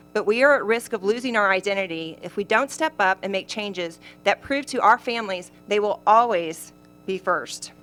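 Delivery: usual speaking voice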